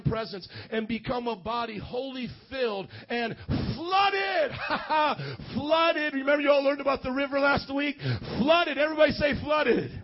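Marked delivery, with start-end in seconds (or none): 3.5-9.9 loud, elated revival shout; joyful, booming preacher with bursts of laughter; exultant preaching. Real, not acted. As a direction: shout it for the crowd to echo. Preacher excitedly rallies crowd, laughing.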